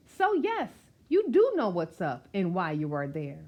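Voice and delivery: plain reading voice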